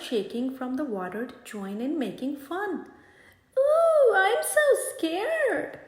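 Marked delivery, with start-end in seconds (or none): 3.6-5.8 much higher, slow, mock-frightened squeal. Otter mocks the old gator, high hammed-up fake fright. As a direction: ham up fake fear to tease. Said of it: "he mocks".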